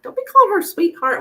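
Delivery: sharp, high and loud scolding; retold scolding. Scolding her boyfriend for sweet-talking the bartender, sharp and high. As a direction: snap it at him like a jealous girlfriend. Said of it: "and I'm like".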